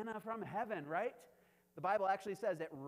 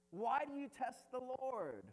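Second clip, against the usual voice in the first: high, drawn-out mock reproach; exasperated Moses; 7 semitones higher, 4 dB louder than usual. Moses rebukes the quarreling people, exasperated reproach. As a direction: challenge them slowly with raised pitch. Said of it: "And Moses is like".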